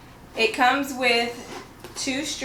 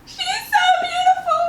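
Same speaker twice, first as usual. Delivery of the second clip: a very high, loud, crying shout of joy; tearful cry of joy; 4 dB louder than usual. Real, not acted. Seeing her new bag, crying out to her viewers in joy. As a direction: cry it out in overwhelmed delight. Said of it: "I'm about to cry".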